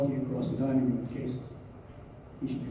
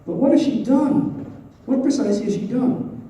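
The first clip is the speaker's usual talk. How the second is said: high, loud, insistent questions; acted indignant challenge; agitated demand; 9 semitones higher, 6 dB louder than usual. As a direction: demand an answer, pitched up and loud. Dolly challenges the accusation in novel.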